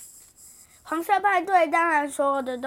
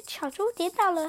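ordinary delivery

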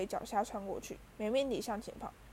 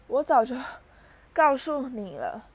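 panting, emphatic scolding; gasping reproach; 3 semitones higher, 7 dB louder than usual. A narrator's reading. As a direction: force out a reproach between breaths. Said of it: "妙丽喘着气说".

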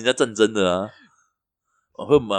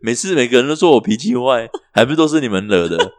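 mock rant; 4 semitones higher, 7 dB louder than usual. Mock rant about his temper.

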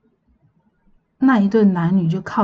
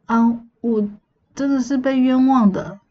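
higher, pleading cry of innocence; crying out wronged; 5 semitones higher than usual. Retold or imitated. Accused couple in hell's court crying out, pleading innocence. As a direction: wail that you were framed. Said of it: "大家都在喊".

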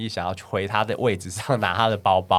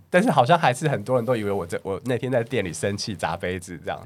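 normal talking voice

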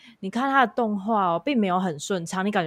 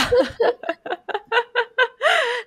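high delighted laugh; 4 dB louder than usual. Real, not acted. Gushing about her idol.

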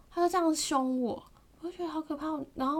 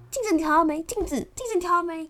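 high, loud harsh yelling; shouting at her to adjust the mirrors; super fierce yelling; 6 semitones higher, 9 dB louder than usual. Retold or imitated. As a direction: bark the question again and again, ever angrier. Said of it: "那种超级无敌凶的欸".

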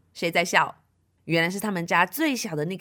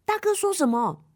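ordinary delivery

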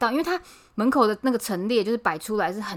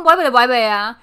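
mock yelling back; 10 dB louder than usual. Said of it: "我就说".

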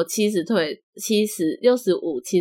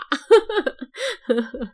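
high giggling laugh; 9 semitones higher than usual. Real, not acted. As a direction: laugh it off happily. Laughs about pets plan.

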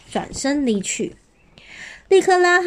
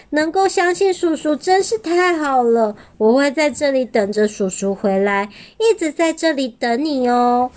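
little dinosaur voice; 6 semitones higher than usual. A narrator's reading. Little dinosaur thanks uncle.

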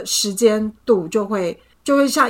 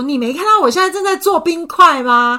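higher, louder exasperated scolding; reenacting snapping at her boyfriend, higher and louder; 8 semitones higher, 8 dB louder than usual. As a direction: scold him for grabbing things now. Retold quarrel with boyfriend.

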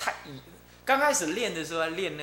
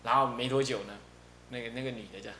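ordinary talk, nothing marked

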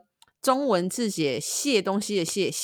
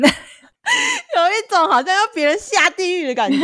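high, loud, slow through laughter; cracking up; 10 semitones higher, 6 dB louder, slower than usual. Real, not acted. Host explaining to co-host a heavy curse, cracking up. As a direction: laugh out the exaggerated verdict.

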